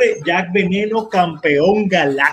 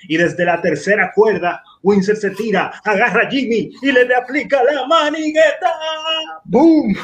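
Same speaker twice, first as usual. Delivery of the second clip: wrestling commentator; 6 semitones higher than usual. Pretend. Mock wrestling play-by-play.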